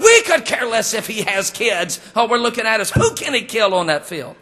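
high, loud mock rant; hammed-up outburst of a man who only cares about the game; exasperated football fan. Husband answering wife's questions at football game, exasperated.

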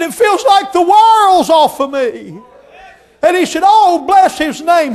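high loud slow rejoicing; joyful shouting. Retold or imitated. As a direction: praise it out with relief. Man rejoicing after prayer.

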